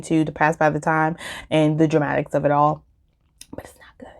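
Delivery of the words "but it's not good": hushed, secretive whisper; whispered aside. Real, not acted. Reviewer confiding to viewers that the book is bad, whispered. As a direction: confide it under your breath.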